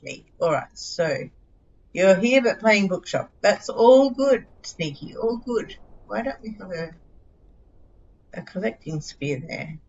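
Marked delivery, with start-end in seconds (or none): none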